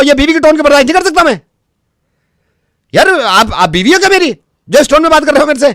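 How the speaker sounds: from firm objection to high-pitched outrage; mounting indignation. Acted. Technician objects to customer's tone, indignation building. Prank technician starts quarrel over tone.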